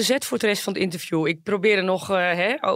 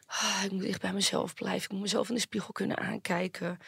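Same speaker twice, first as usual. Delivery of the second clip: mocking artist cliché; 9 dB quieter than usual. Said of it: "het gaat allemaal over".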